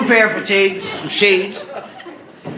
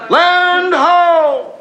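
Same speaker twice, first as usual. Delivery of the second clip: yell; 8 semitones higher, 4 dB louder than usual. Acted. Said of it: "the guy in the mast yells".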